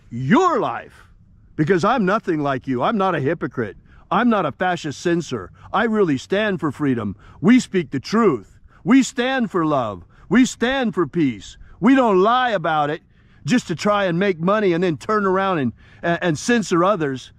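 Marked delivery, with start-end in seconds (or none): none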